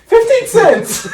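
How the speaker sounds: high slow laughing squeak; Gary Vee impression. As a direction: stretch it high through laughter. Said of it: "It's like".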